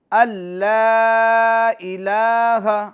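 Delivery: اللہ الہ stretched slowly, the madd held long; slow recitation. Shown as a demonstration. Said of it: "ان لانی ہے".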